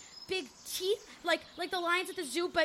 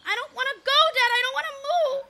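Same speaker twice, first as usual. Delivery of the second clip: whiny crying plea; tearful pleading; 7 semitones higher, 7 dB louder than usual. Acted. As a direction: beg on the edge of tears. Child pleads not to move.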